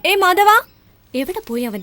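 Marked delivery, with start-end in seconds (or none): 0.1-0.5 loud, raised call. Acted. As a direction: call out for someone in the house. Calling out for Madhavan. (ഉറക്കെ നീട്ടിവിളിച്ച്)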